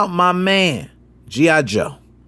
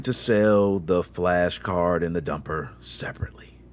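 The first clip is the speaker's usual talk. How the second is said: whisper; 12 semitones lower, 6 dB quieter than usual. Retold or imitated. Bad-news announcement, whispered.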